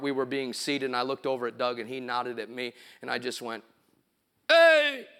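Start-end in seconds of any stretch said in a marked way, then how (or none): 4.5-5.1 high, loud "hey" burst; sudden held yelp; loud prank yell. Retold or imitated. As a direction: holler to outdo a friend in choir class. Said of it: "make the loudest noise".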